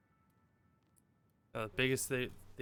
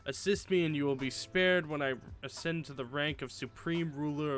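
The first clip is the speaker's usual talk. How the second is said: theatrical evil mastermind voice; panto villain; 3 dB louder than usual. Acted. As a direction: ham it up like a cartoon villain. Reads villain's email in voice.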